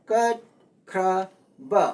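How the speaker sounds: ꯀꯠꯈ꯭ꯔꯕ slowly split into three separate syllables. Shown as a demonstration. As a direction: break ꯀꯠꯈ꯭ꯔꯕ into three beats, a clear pause between each. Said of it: "three syllable ꯑꯁꯤ". (ꯀꯠꯈ꯭ꯔꯕ ꯈꯣꯟꯊꯣꯛ ꯑꯍꯨꯝ ꯇꯣꯉꯥꯟꯅ ꯇꯞꯅ ꯍꯥꯏꯕ)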